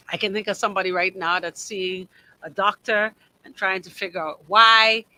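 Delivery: ordinary delivery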